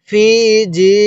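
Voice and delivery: long steady i in ফি and জি; one-alif stretch. Shown as a demonstration. Teacher demonstrating the stretching rule, long vowels in ফি and জি. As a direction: draw out ফি and জি evenly, one at a time. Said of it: "এক আলিফ টানতে হবে".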